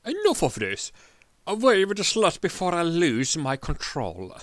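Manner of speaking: high, loud furious order; king's outburst. Acted. King ordering the prisoner away, high furious shout. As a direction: snap and shut him up.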